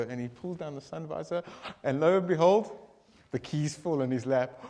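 ordinary talk

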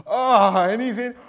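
a sudden loud, high oh; excited gasp. Acted. Man finds tractor keys in his lap, loud excited oh. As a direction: gasp with sheer delight at the find.

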